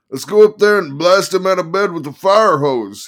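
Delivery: high, loud manic lawyer; excited manic attorney. Acted.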